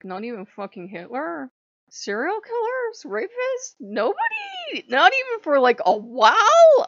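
incredulous, squeaky-high questioning; mock incredulous. Acted incredulous challenge to God.

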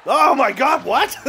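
high, loud disbelieving shout; stunned delight. Real, not acted. Streamer watching his own goal go in, shouting in disbelief. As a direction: yell it like you can't believe the goal. His player just scored.

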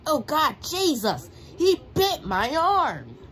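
high, loud yelp of pain-shock; startled yell. Real, not acted. Gamer yelling at the fight on screen, a loud shriek. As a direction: yell it as if just bitten.

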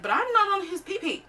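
high, mock-indignant denial; hammed-up wounded denial; protesting innocence. Acts out her protest of innocence.

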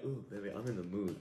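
lightly voiced low, soft flirty invitation. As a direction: tease her as a breathy seductress. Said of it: "you're like".

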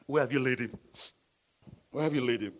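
low, quiet, tearful question; silent weeping. Acted. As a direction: act out holding back quiet tears. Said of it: "means to weep silently".

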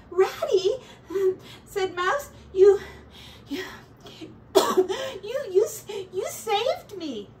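high, halting, near tears; choked-up thanks. A narrator's reading. Mouse chokes out his thanks to Ratty. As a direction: stammer out thanks through emotion.

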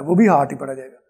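normal talking voice

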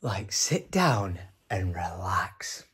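slow whispered aside; whispering, low and slow, relief at being home alone. Real, not acted. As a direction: breathe it out slowly like a secret.

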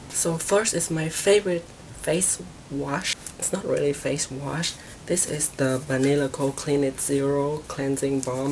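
normal talking voice

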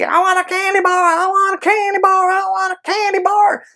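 put-on high, far-off child voice begging over and over; whiny child. Child at the grocery store begging a parent, high whiny voice. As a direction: whine it like a kid who wants candy.

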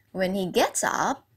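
ordinary talk

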